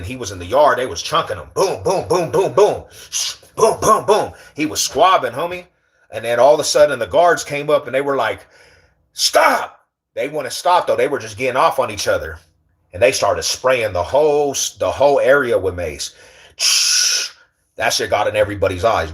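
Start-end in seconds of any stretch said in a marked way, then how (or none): 1.5-4.3 rapid high-pitched "boom" punch noises; punching sound effects. Retold or imitated. Telling viewers about a yard fight, imitated punches. 9.1-9.9 high-pitched mock guard yell; guard's shouted order. Retelling guards breaking up a fight, high mock shout. 16.6-17.3 long hissed "shh" like a spray can; mace spray noise. Retold or imitated. Telling how guards maced the area, hissing spray sound.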